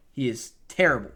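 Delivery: "terrible" set apart and hit hard; emphasis on TERRIBLE. Real, not acted. Fan judging the team's worst safety, emphasis on "terrible". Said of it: "Emphasis on the word terrible".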